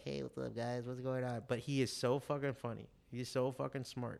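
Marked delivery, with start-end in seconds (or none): 0.0-1.4 lightly voiced shy nerd, softer; a hint of a timid nerd greeting; soft mock nerdy hello; nerdy kid. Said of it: "like, the most nerdy".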